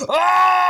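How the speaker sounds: sudden high yelp of fright; startled scream. Acted. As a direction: shriek as you step on it. Harry trods on something.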